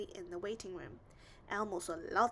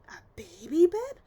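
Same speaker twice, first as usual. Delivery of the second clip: higher, much louder barked outrage; offended bark; 6 semitones higher, 14 dB louder than usual. A narrator's reading. Wolf refuses a baby bib: offended bark. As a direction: bark it out, insulted. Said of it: "barked the wolf".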